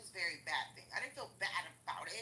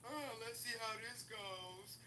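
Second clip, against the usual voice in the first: slow and softer, cautious and unsure; slow wary mock voice; 6 dB quieter, slower than usual. Brain up top voiced as a slow, wary skeptic. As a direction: drawl it out like you're testing the waters. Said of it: "the brain up top said".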